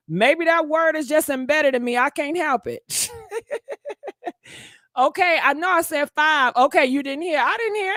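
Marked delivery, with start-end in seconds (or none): 3.2-4.3 a high, soft giggle of amusement; high breathy giggle. Real, not acted. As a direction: giggle to yourself. Laughing at herself.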